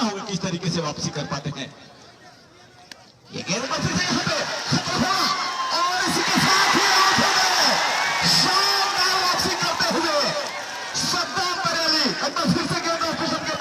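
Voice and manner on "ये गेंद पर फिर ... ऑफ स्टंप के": high shouting wicket call; excited cricket commentary. Real, not acted.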